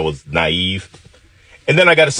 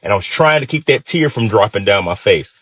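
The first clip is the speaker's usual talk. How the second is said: plain reading voice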